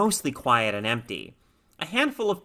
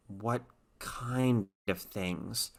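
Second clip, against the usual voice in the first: slow, very soft dry whisper; 4 semitones lower, 11 dB quieter, much slower than usual. A narrator's reading. Lisa asks the doctor in a dry whisper. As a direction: barely breathe the words out. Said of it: "Lisa's voice was a dry whisper".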